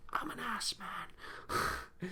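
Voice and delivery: hushed secret whisper. Real, not acted. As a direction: breathe it out like a guilty secret. Whispered confession on a family channel.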